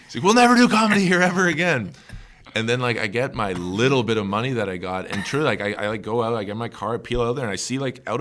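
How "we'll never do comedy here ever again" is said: high, loud, fast mock rant; Willie freaking out. Comic retelling the owner banning comedy, mock freak-out. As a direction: imitate an enraged bar owner yelling. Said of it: "was the guy freaking out".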